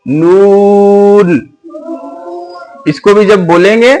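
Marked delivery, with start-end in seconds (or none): 0.0-1.5 नून drawn out slowly, without the nasal carrying on; stretched noon. Shown as a demonstration. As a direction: start नून in the nose, then hold it clear and long. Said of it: "इसको भी खींच के बोलना है".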